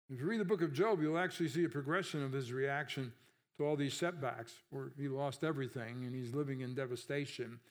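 ordinary talk, nothing marked

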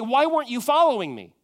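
loud, high, indignant demands; whiny, aggrieved questions thrown at God; complaining to Jesus. Retold or imitated. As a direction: voice the petulant believer venting at Jesus. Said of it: "And we say".

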